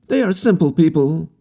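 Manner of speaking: put-on an eager young wanderer reassuring; harlequin youth. A narrator's reading. Harlequin assuring the captain about the natives, high and earnest.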